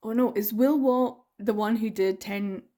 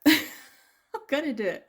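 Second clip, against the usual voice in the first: laughing; 5 semitones higher, slower than usual. Real, not acted. Repeats decision, laughing.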